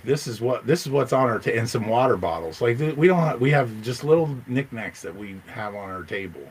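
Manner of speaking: ordinary delivery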